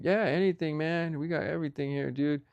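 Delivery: a hint of a hyped-up taco seller; hyped buddy. Taco guy boasting to a customer, lightly hyped bro.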